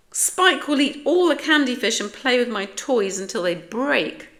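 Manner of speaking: high, loud complaint; indignant kitten's outburst, pitched high; worried protest. A narrator's reading. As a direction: protest loudly, punching each word. Splat protests about Spike's visit.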